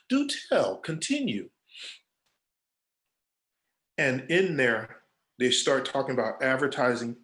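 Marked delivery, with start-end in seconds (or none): 0.1-1.5 mock intrigue. Mock-interested sober listener.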